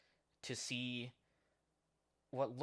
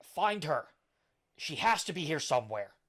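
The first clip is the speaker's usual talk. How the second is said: loud, higher pirate shout; pirate yelling out; 4 semitones higher, 7 dB louder than usual. Acted. Pirate yelling orders to his crew mid-raid, loud shout. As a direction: yell it like a raider taking a ship. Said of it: "is yelling out to the others".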